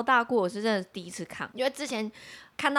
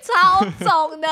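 slow, high words stretched by laughter; laughing slowly; 8 semitones higher, 6 dB louder, slower than usual. Real, not acted. Describing the friend's swollen eyes, slow and laughing. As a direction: drag it out between laughs.

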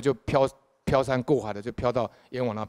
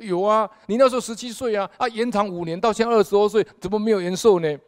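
high, loud judge's retort; King Yama retorting to the dead man, high and loud; booming judge; 9 semitones higher, 6 dB louder than usual. Retold or imitated. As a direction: answer like the king of hell scolding. King Yama answers loudly.

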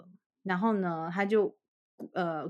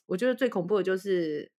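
usual speaking voice

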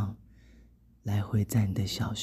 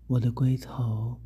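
plain reading voice

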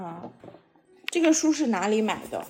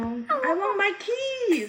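higher and slow, a lightly voiced cartoon character; storybook character voice; 9 semitones higher, much slower than usual. Mother reading a picture book to her son, lightly voicing the character. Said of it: "他说".

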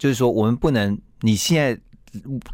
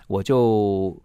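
usual speaking voice

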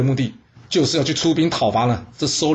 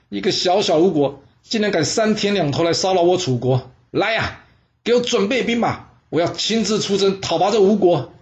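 high, raised voice of an angry king; furious king; 6 semitones higher than usual. Acted. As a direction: order the troops out in a rage. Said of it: "他非常生气啊". (楚灵王被吴国激怒，高声下令出征)

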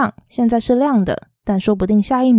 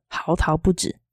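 ordinary delivery

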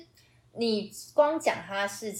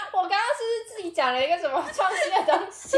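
laughing; 11 semitones higher, 3 dB louder than usual. Real, not acted. Laughs at own new phrase.

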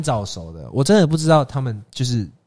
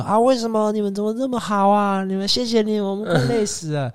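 mock grateful adults; 9 semitones higher than usual. Adults' grateful reply, imitated.

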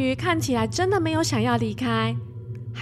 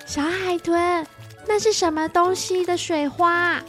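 put-on little girl voice; 6 semitones higher than usual. Child Qianqian voiced asking.

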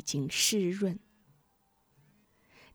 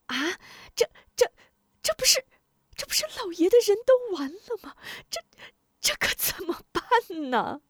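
high, fast, stammering alarm; shocked stammer; 14 semitones higher, faster than usual. A narrator's reading. Wife hears his men are gone, high shocked stammering. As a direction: stutter in sudden shock. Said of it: "贾夫人大吃一惊".

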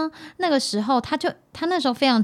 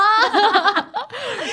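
loud falling laugh; 12 semitones higher, 5 dB louder than usual. Real, not acted. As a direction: crack up laughing. Laughs after retelling fight.

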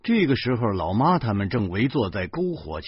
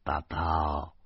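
softer, slow and gentle; tender; 4 semitones lower, 5 dB quieter, much slower than usual. Acted. Crouching father figure letting the little girl down gently, soft and slow. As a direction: coax a small child kindly. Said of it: "我亲切地说".

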